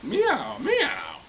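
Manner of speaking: very high storybook mewing; high, swooping cat cry; cat meow. A narrator's reading. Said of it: "cried the cat".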